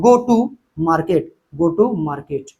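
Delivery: slow dictation. Shown as a demonstration. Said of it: "slow dictation while writing".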